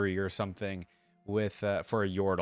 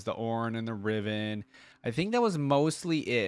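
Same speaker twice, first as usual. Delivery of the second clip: usual speaking voice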